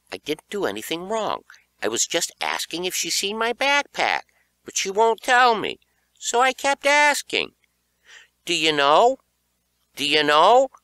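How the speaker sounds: high, rising whiny complaint; whiny kid protesting. A narrator's reading. Boy protesting to his friend Beaver, high and whiny. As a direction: plead your innocence like a wronged kid. Narrator's younger self pleading.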